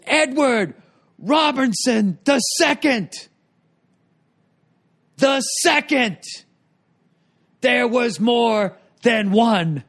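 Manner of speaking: high, drawn-out announcer call; ring announcer impression. Mimicking the MC mocking "the second" to the crowd. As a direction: stretch each word like a ring MC. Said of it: "I'm butchering his delivery".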